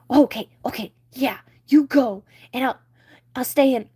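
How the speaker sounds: high, loud panicky fish; scared Flounder. Acted. Flounder scared of sharks, high panicky voice. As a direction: fake bravado cracking into panic.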